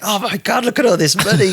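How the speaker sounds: giddy excitement. Retold or imitated. Driver staring at cash on the bed, giddy and excited. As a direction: gush like you just won the lottery. Money spread over the bed.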